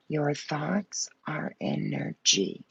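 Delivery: slow hushed whisper. Real, not acted. Reader reminding viewers in a slow whisper. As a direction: whisper it slowly like a secret.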